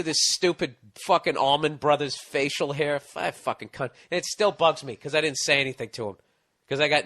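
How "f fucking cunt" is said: usual speaking voice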